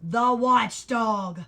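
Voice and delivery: very slow, loud alarmed shout. A narrator's reading. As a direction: cry out the warning, dragging it. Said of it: "shouted another".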